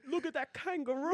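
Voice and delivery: high, loud joke Australian; Australian accent. Acted. Topping a friend's attempt among laughter, Australian accent. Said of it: "Australian accent is the same as British".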